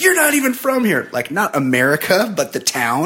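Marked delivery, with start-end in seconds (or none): 0.0-1.1 high-pitched imitation of an irate wife; mock a woman yelling, without full volume; mild mock scream. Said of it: "starts screaming at".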